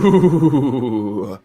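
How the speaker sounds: low, drawn-out ghostly ooh; haunt them with a Halloween ooh; spooky voice. Pretend. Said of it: "That was my spooky voice".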